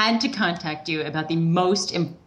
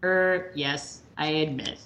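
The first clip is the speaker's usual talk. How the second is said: normal talking voice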